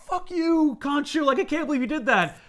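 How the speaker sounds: high-pitched mock outrage; indignant outburst. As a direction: act out disbelieving fury without shouting. Acted outburst at a betraying god.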